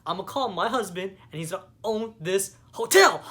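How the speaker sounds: sassy mock rebuke, climbing to a loud, high finish; defiant boast, rising to a shout; mock Eleanor. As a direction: play the rich mother-in-law putting the clerk in his place. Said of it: "she's like".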